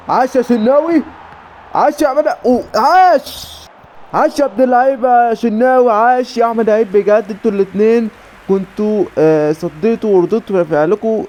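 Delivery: high, thrilled cheering; excited praise. Real, not acted. Gamer praising keeper Shennawy's save, excited cheers.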